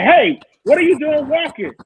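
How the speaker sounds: high-pitched mock call from a car; retold calling out. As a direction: imitate a driver hailing someone walking.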